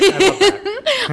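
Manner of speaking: high, slow laugh through the words; delighted laugh as she says it. Real, not acted. Host laughing at the love-letter tactic, delighted.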